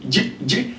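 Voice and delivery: soft short J sound, no vowel; bare consonant J. Shown as a demonstration. Teacher correcting the class on a bare J. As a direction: quietly tap J, no vowel after it. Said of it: "the sound is J".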